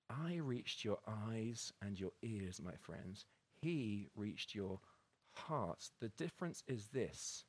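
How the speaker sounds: hushed and slow, trembling with feeling; voice shaking. Retold or imitated. Actor speaks to the gathered guests, voice shaking and hushed. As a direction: let the voice shake, quiet and slow. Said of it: "his voice shook as he laid his hand".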